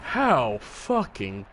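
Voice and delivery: slow, high, haughty indignation; hammed-up indignant outburst, swooping high; mock outrage. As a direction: imitate an outraged opponent, stretching each word. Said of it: "he was like".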